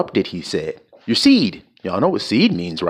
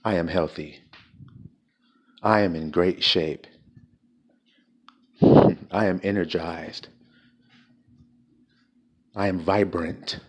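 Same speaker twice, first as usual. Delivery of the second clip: softer and lower, gentle I-am statements; tender affirmations; 3 semitones lower, 4 dB quieter than usual. Shown as a demonstration. Preacher modelling affirmations for his wife, soft and tender. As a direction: coax his wife gently through each line.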